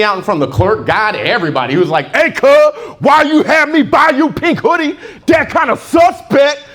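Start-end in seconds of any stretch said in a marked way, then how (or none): 2.1-6.6 all swagger, drop the consonants; thug talk. Retold or imitated. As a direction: cousin calling him out over the pink hoodie. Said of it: "You know how thugs talk, all confidence".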